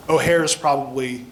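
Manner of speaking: ordinary delivery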